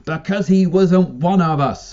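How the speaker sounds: sudden outburst; blurted out. A narrator's reading. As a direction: blurt it out loud. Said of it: "blurted out".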